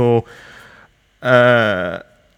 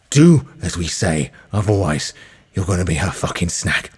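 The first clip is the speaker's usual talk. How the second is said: low threat; 4 semitones lower than usual. Acted. Brian threatening Rochelle, low and menacing. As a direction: lean in close and threaten.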